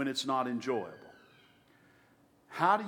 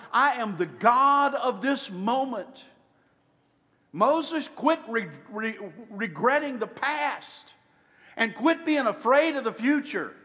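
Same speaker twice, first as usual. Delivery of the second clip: louder, rising preacher's cry; full-force preaching; 7 semitones higher, 4 dB louder than usual. Acted. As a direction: preach it out to the whole hall. Preaching God's answer at full force.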